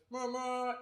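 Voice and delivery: high, soft honking noise; two-note toot done by voice; imitated horn. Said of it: "he has this horn".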